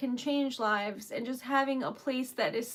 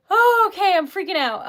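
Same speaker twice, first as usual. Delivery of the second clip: ordinary talk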